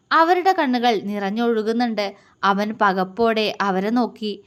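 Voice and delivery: ordinary delivery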